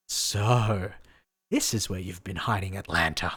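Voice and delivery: low, menacing villain. Acted. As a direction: read it as a cold villain. Voices villain reading dialogue.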